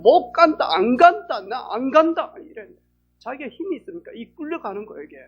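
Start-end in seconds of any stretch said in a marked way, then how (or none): 0.0-2.3 refusing shout. Retold or imitated. Sister fighting the grim reaper.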